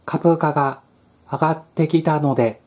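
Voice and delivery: flat text-to-speech reading; robot voice. Retold or imitated. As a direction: read it like an AI voice. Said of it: "棒読みのような".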